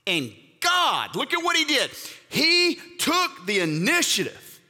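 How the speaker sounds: high, urgent preaching push; fervent preaching. Acted. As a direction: drive it home to the congregation. Preaching at full force.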